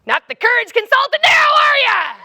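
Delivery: high, loud heckle rising as it goes; jeering shout. Retold or imitated. Onlooker below taunting the stuck courage consultant, jeering yell. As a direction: holler it up as a mocking taunt.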